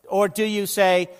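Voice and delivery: normal talking voice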